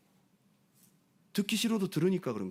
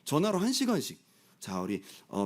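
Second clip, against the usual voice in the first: ordinary talk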